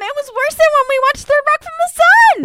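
high, loud exasperated comparison; high, loud, exasperated joke. Real, not acted. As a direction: crank up the frustration. Venting about the show.

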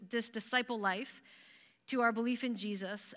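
ordinary delivery

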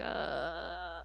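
low, soft, unimpressed grunt; a flat little groan of disgust; mock Kagome grunt. Imitating Kagome, low unimpressed grunt at her daughter. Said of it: "Kagome's like".

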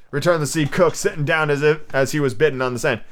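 ordinary delivery

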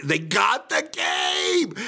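high, drawn-out cry of triumph; acted-out thrilled discovery; elated shout. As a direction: stretch it out in hammed-up joy. Acts joy at finding game.

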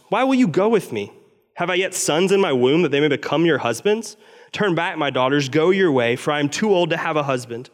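measured, earnest Bible reading; scripture reading. Acted. Preacher reading Ruth aloud to the church, earnest. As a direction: read the passage to the congregation.

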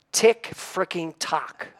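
slow, spaced-out words with a laugh; drawn-out quote. Retold or imitated. Quoting Holland to the congregation, slowly and laughing. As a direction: stretch each word out with amusement.

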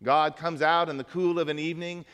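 normal talking voice

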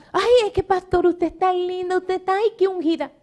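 high gushing voice; mocking, sugary compliments; mock flatterer. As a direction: gush like someone buttering up the pastor. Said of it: "Cuando una persona comienza".

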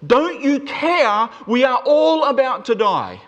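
high, loud, drawn-out cry of alarm; incredibly fearful. Acted. Disciples in the storm crying to Jesus, fearful. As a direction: shout it as if about to drown. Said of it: "incredibly frightening, incredibly fearful".

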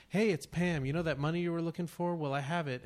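chirpy sales pitch on a recording; scam caller voice. Retold or imitated. Voicing a scam call message.

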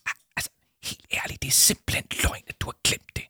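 exasperated stage whisper; voiceless indignant hiss. Acted. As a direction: accuse him in a hushed voice. Whispered accusation under the coat.

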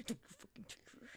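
very soft, voiceless warning; whispered threat. Acted. Friend voices an angel warning off a rival, whispered threat.

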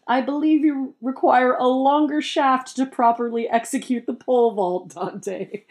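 high haughty put-down voice; snooty voice. Acted. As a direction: deliver it like a snooty aristocrat. Crest speaks snarky line.

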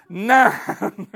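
loud answer breaking into a laugh. Real, not acted. Preacher quizzing the crowd, laughing loud answer. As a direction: shout it and laugh.

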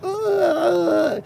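high, drawn-out animal whine; thin mock squeal; imitated raccoon cry. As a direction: wail like a wounded raccoon. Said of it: "It's like".

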